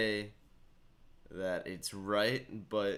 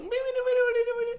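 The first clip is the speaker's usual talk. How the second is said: very high mock chatter; silly high wee noises; high squeaky babble; 6 dB louder, faster than usual. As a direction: squeak like chipmunks. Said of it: "That's literally how they sound".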